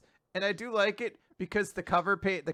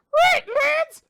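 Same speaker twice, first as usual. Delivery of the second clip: shrill squeak, much higher and louder; squeaky voice; 8 dB louder than usual. Acted. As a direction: squeak it out like a tiny cartoon sister. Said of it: "squeaky voice".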